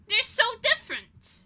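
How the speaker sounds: loud, high exasperated cry; indignant outburst. Real, not acted. Insisting to listeners her shoes differ, loud and indignant. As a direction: blurt it out, fed up. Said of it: "I'm so mad still".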